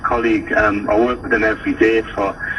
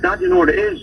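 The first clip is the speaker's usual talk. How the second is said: put-on much higher kid voice; son's voice. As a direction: pitch up high to play the young son. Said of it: "my son Jack said".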